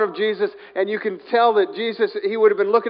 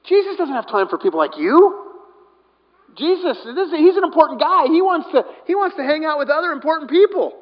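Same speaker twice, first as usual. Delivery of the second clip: high-pitched, scolding put-down; stern telling-off; 8 semitones higher than usual. Acted. Crowd scolding the blind beggar, stern telling-off. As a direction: put the nobody in his place, voice pitched up. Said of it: "many were sternly telling him to be quiet".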